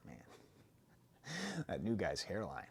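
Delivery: dropped low and soft, muttered aside; quiet inner thought. Acted. Competitive part of you eyeing the new guy's hairline, muttered. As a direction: let the voice sink into a private grumble.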